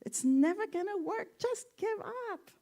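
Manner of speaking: very high, squeaky discouraging cry; high frog voice. Retold or imitated. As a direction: pitch it way up like a little frog. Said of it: "the frog who's on the top says".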